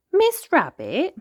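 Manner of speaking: high, slow little pig voice; a small girl piglet calling out; Peppa voice. Acted.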